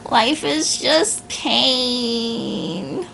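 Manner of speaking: drawn-out, very slow gloomy sigh of a line; mock melodramatic despair. Speaker parodying a nihilist, slow mock despair. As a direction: stretch it like a dramatic moper.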